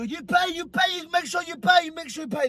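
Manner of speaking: high mimicked nagging about payment; imitated pushy staff, repeating urgently; insistent nagging. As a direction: pester in a high, thin mock voice. Staff pushing him to pay.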